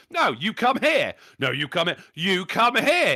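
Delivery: loud, high, drawn-out demands; mock-heated back-and-forth insistence; stubborn standoff. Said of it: "standoff of both of us going".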